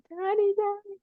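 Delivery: put-on high, childlike plea to her father; scared cry for daddy. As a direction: call out for daddy in pain. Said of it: "I'm like".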